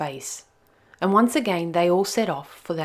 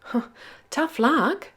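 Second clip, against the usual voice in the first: Nell's voice; 8 semitones higher, slower than usual. Acted. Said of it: "said Little Nell".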